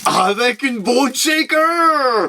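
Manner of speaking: high, long drawn-out fanfare; hammed-up reveal. Vlogger revealing the toy on a keyring, hammed-up excitement. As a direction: swell it out slowly, high and showy.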